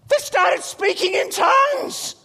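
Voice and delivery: slow, high, loud shout; amazed child. Retold or imitated. Son telling dad what happened next, slow excited shout. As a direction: shout the amazing news like a kid.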